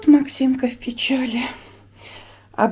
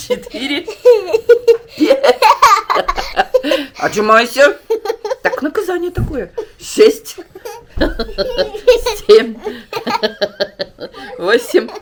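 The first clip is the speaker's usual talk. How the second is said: laughing; 8 semitones higher, 6 dB louder than usual. Real, not acted. Counting son's push-ups, laughing.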